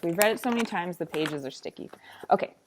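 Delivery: ordinary delivery, nothing marked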